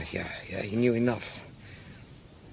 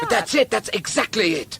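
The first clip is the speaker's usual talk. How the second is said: high burst of sudden realisation; excited; 11 semitones higher, 4 dB louder than usual. Acted. Man struck by the camel idea, excited. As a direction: seize on the idea eagerly.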